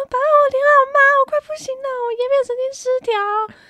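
high, loud whiny panic; hammed-up distressed whine; panicked wail. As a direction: wail to dad that you're dying. Begging dad after sting.

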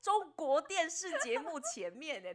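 high, laughing through the words; crying with laughter while accusing. Real, not acted. Laughing, mock accusing friends.

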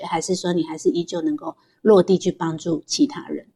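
ordinary talk

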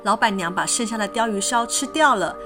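usual speaking voice

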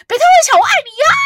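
high excited shout of a fan; shouted fan call. Retold or imitated. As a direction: yell it like a fan across the campsite. Fans shouting from the campsite.

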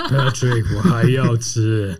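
low, slow deep-voice parody, laughing; Dwayne Johnson voice. Retold or imitated. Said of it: "巨石强森的声音".

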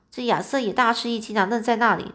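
usual speaking voice

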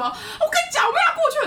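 high, loud hammed-up shout with a laugh; laughing mock rant; mock grumpy shout. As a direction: play the hot-tempered friend yelling, half laughing. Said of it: "他就很暴躁".

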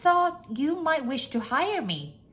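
high maid voice, projecting; eager maid. A narrator's reading. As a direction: pitch it high, like a maid pleading for a job. Maid seeks job from master.

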